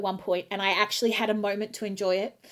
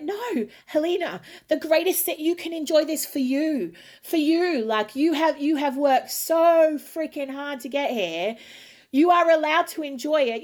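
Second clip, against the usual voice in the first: raised, emphatic self-encouragement; self pep talk; 6 semitones higher than usual. Retold or imitated. Singer telling herself to enjoy it, emphatic pep talk. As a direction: insist firmly, talking yourself round.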